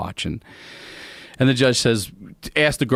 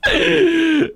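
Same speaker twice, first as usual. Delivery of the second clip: high wheezing cackle; shrieking laugh; 4 dB louder than usual. Real, not acted. Storyteller recalling the brick arrest, helpless high laughter. Cracks up at his own story.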